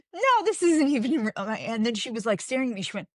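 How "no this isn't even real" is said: pitched well up, laughing insistence; high amused no, it's a wig. Retold or imitated. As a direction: insist it's fake with a grin. Telling a reader about her hair.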